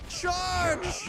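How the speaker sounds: high shouted charge; battle cry. Real, not acted. Gamer rallying companions into battle, shouting. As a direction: yell it to rally the giant. Calling attack in fight.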